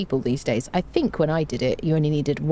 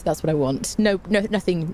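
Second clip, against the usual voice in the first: ordinary talk, nothing marked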